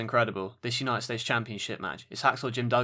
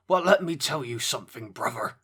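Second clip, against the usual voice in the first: a quick gruff wrestler catchphrase, lightly voiced; Hulk Hogan impression; 3 semitones higher than usual. Podcast host teasing co-host with a Hulk Hogan catchphrase, lightly voiced. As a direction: growl it like a wrestling legend on the mic. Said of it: "your best impression of Hulk Hogan".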